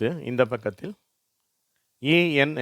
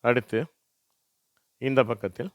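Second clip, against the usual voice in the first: normal talking voice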